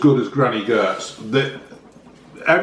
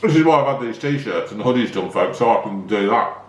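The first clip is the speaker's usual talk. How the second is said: talking through a mouthful of cake; words round a bite of cake; mouth full; 4 semitones lower than usual. Real, not acted. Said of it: "Not speak with your mouth full".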